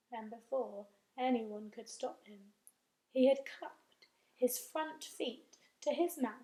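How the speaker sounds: plain reading voice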